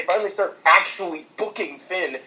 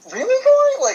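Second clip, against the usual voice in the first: incredulous; 7 dB louder than usual. Real, not acted. Disbelief at commentator's jab.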